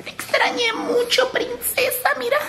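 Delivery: high soft pet voice. Real, not acted. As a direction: coo gently to the dog. Talking to pet dog.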